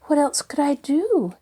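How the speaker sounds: plead, worried and flustered; much disturbed. Acted. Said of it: "much disturbed".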